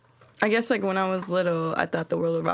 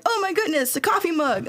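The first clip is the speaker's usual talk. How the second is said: mock excited kid; 9 semitones higher than usual. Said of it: "I'm like".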